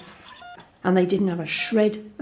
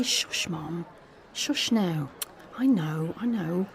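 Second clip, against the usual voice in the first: softer, hushed comfort, lower; soothing hush; 3 semitones lower, 6 dB quieter, much slower than usual. Acted. Son hushing his crying mum in a café, soft soothing hush. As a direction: quietly settle her so people won't stare.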